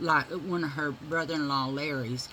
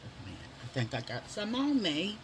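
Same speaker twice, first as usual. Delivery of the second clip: ordinary delivery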